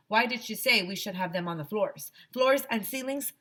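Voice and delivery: ordinary delivery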